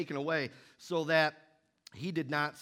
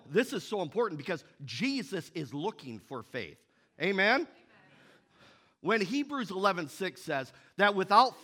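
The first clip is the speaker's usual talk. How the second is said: preaching; 4 semitones higher, 5 dB louder than usual. Acted. Preaching at full force.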